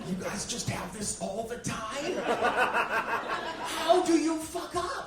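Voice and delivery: high, loud laughing disbelief; incredulous rant. Retold or imitated. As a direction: shout your disbelief at them. Ranting at neurotypicals.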